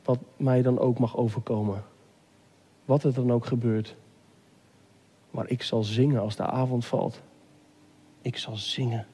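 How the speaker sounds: low, hushed resolve; soft broken voice. Acted. Believer singing as evening falls, softly broken. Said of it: "of fluister je het zacht met, met een gebroken stem".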